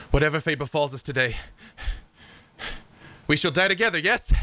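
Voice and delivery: high, fervent oath; noble knight. Acted. Armand to his brother before battle, fervent high vow. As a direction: swear it like a doomed knight. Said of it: "he's like".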